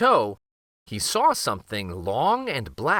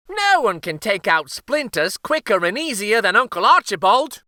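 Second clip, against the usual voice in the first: higher, louder eager brag; cook Gloria, chatty; 9 semitones higher, 6 dB louder than usual. Acted. Cook to the king, eagerly praising her uncle. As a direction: boast about her uncle. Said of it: "said Gloria".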